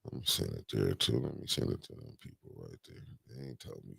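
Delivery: low, quiet mumble to himself, imitating a Facebook sharer; muttering mock sharer. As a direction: lightly mutter it like someone forwarding posts. Mimics people forwarding Facebook posts.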